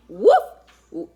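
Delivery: a sudden loud dog bark; loud playful woof. Acted. Calling the wolf clan, a loud playful woof.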